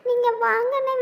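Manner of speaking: ordinary talk, nothing marked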